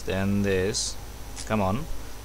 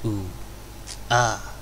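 usual speaking voice

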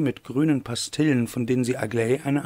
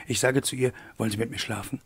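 ordinary delivery

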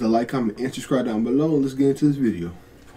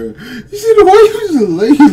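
laughing; 12 semitones higher, 7 dB louder than usual. Real, not acted. Laughing at a knocked-out player.

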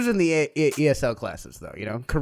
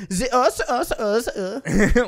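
higher-pitched fake German syllables, laughing; mock German; 6 semitones higher, much slower than usual. Comedian mocking the German language, fake German gibberish. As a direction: make up buzzy German-sounding nonsense. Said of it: "making up fucking syllables and doing the z".

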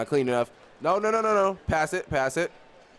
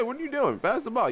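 ordinary talk, nothing marked

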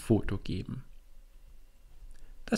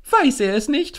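startled; 13 semitones higher, 7 dB louder than usual. Acted. Tina startled at his ignorance.

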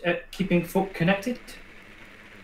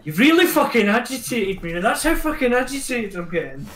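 higher, exaggerated irritation; mock agitation; 7 semitones higher than usual. Real, not acted. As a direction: ham up being wound up. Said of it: "I'm agitated".

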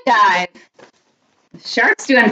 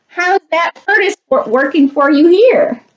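higher-pitched shark character voice; shark's taunt; 6 semitones higher than usual. A narrator's reading. Shark needles the train in the ocean, teasing higher voice.

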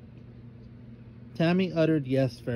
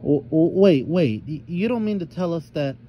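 1 stammering shock; 4 semitones higher, 7 dB louder than usual. A narrator's reading.

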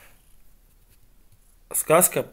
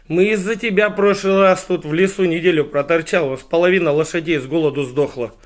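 loud, raised-pitch acted complaint; exasperated rant; 5 semitones higher, 8 dB louder than usual. Ali Baba blames the hedgehog for dead horses, ranting. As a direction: pour out the grievance at full voice.

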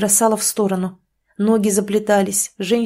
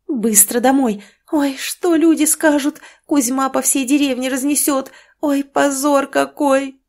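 lamenting scolding; 6 semitones higher than usual. Acted. Mother lamenting the disgrace.

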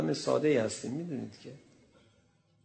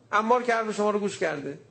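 mocking taunt; 8 semitones higher than usual.

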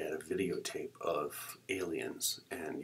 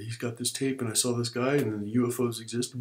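raised, louder frantic explaining; mounting retold panic; 5 semitones higher, 7 dB louder than usual. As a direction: build toward hysteria as you explain. Babbles his panic to sponsor.

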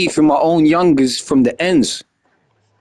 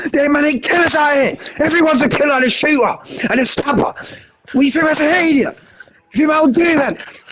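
retold angry outburst; 10 semitones higher than usual. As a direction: replay the blow-up, pitched way up and shouting. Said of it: "I flipped so bad".

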